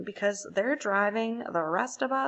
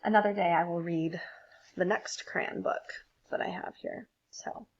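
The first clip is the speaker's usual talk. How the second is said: whispered aside; 3 semitones lower than usual. Real, not acted. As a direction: whisper it to the listeners. Reader closes quietly.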